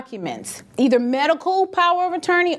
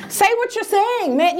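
indignant demand; 10 semitones higher than usual. Retold or imitated. Demanding retort.

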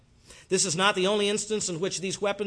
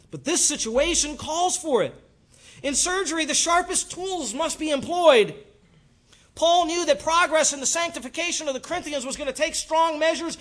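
high-pitched forceful preaching, rushing; intense preaching; 10 semitones higher, 4 dB louder than usual. Real, not acted. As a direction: drive the point home with urgency. Preacher builds to an emphatic point.

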